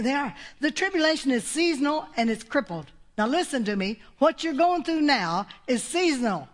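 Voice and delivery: ordinary delivery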